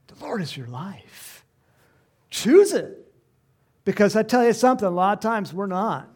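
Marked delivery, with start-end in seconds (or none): 2.3-3.1 shouted high and loud, a sudden burst; loud command. Acted. Preacher calling the congregation to choose life, loud shout. 3.8-6.1 urgent preaching. Acted. Preacher pressing point.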